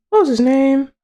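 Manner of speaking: high squeaky question; puzzled falsetto. Pretend. Trying to remember the octopus's name, puzzled falsetto. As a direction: ask it up in falsetto.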